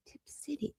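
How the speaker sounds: breathy whispered read of a comment. Real, not acted. Streamer whispering a viewer's question to the chat. Repeating the town name to herself.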